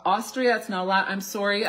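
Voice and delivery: ordinary talk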